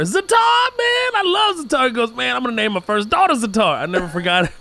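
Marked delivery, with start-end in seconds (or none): 0.0-1.9 put-on much higher, louder, delighted kid voice; a boy raving over a snack; excited classmate. Bruce raving about food. 2.1-3.8 eager classmate. Retold or imitated. Bruce's vow.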